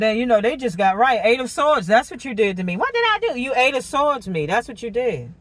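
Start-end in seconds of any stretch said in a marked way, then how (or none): 2.8-3.4 high, fake-innocent protest; mock innocent. Imitating them asking innocently, voice leaping high. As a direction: play dumb, pitch jumping way up.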